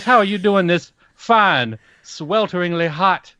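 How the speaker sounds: slow Southern drawl with a courtly lilt; Alabama drawl. Real, not acted. Co-host asking the host about the hot evening, Alabama drawl. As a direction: draw it out slowly, Alabama style. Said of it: "you sound like Kevin Spacey's character".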